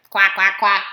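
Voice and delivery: low quacking duck voice. Acted. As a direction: quack like a duck giving a speech. Duck's speeches only ducks understand.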